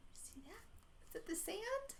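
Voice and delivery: breathy whispered wonder; soft whispered question. A narrator's reading. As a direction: whisper to the child. Narrator whispers aside.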